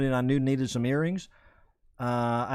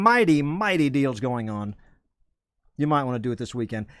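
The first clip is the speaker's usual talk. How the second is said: ordinary talk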